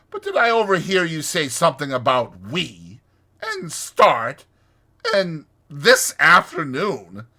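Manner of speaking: pointed, loud, with pauses before each piece; slow. A narrator's reading. Rat querying what he overheard from Toad, slow. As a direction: pick out the offending words one by one. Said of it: "said the rat slowly".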